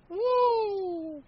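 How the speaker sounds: high mock woo; imitating a crowd cheering a hold. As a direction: whoop like the whole arena. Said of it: "causes everyone to go".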